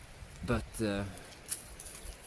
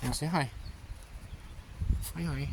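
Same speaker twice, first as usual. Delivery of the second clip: sing-song encouragement to a little one; 7 semitones higher than usual. Real, not acted. Coaxing a child to greet.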